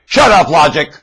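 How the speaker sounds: loud shouted command; mock yell. Preacher voicing sellers yelling at logic, a loud shout. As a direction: yell it like they do. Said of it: "That's what they're yelling".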